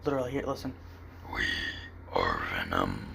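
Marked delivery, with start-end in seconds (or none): 1.3-3.1 low, throaty whispered creature voice; Venom impression. Shown as a demonstration. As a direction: rasp it like an alien symbiote. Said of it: "It's pretty much my Venom impression".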